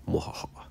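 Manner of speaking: whispered evil cackle; breathy villainous mwahaha; mad scientist laugh. Pretend. Gloating villain laugh after plan.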